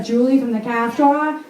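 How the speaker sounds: put-on New England accent, R's dropped in "car store"; Lois. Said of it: "just like Lois".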